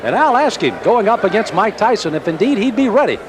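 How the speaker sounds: ordinary talk, nothing marked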